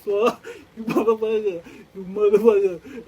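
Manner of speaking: loud, laughing mock curse; laughing insult. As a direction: curse him out through a laugh.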